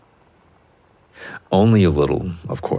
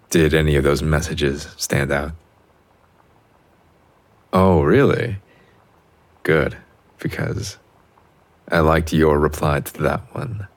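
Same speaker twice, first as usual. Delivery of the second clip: normal talking voice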